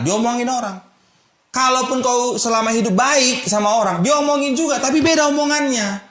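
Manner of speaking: fiery pulpit climax; rising preaching. Acted. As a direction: build the sermon to a ringing peak.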